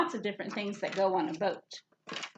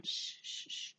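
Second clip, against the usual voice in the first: soft hissed shh shh shh; shushing sound; 12 dB quieter than usual. Shown as a demonstration. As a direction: hush like telling kids to be quiet. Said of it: "doesn't have the S H sound".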